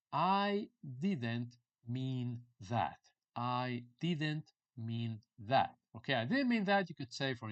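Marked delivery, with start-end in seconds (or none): 0.1-3.0 word by word, long drawn out; dictation-slow teaching delivery; very slow model phrase. Shown as a demonstration. 3.4-5.8 word by word, long drawn out; dictation-slow teaching delivery; very slow model phrase. Shown as a demonstration.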